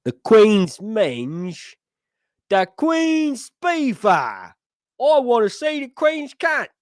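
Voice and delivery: high, loud, drawn-out mock accent; over-the-top comic yell; hammed-up shouted impression. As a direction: bellow it high and slow, stretching the vowels. Hammed-up accented line about the Queen.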